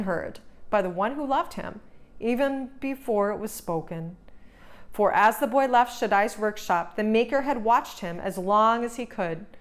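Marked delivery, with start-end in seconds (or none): none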